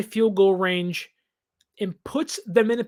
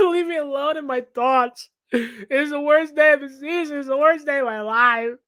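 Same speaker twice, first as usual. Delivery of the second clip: hammed-up tragic lament, pitched higher; melodramatic despair; 5 semitones higher, 3 dB louder than usual. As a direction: whine like it's the end of the world. Dramatic reply to his fiancée.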